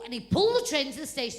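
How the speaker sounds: ordinary delivery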